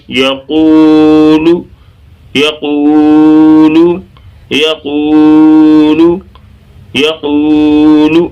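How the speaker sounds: long held middle vowel in یقول, short ending; slow chant on یقول, stretching the qoo; drawn-out recitation. Shown as a demonstration. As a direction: hold the middle of یقول, then release briefly. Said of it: "تو یہاں تک پڑھ لیں".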